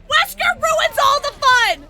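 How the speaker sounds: high, loud, slow, drawn-out wail; wailing complaint. Real, not acted. Player blames Wesker for spoiling the game, wailing complaint. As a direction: wail it at full volume.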